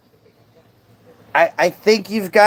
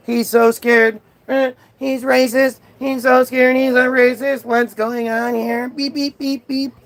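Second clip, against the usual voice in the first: mock bot voice, higher-pitched and repetitive; malfunctioning bot; 4 semitones higher than usual. Streamer mocks heckler as a bot resetting, malfunctioning bot impression. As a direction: imitate a glitching bot ending in beeps. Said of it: "The bot is overriding itself".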